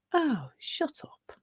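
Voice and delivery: mock exasperation, dropping away on the last word; dismissive retort. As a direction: brush off a pest. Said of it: "you're just like".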